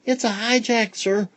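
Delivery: put-on high eager kid voice; high little pupil telling teacher; child's voice. A narrator's reading. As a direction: play an excited schoolchild. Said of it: "the children were telling him".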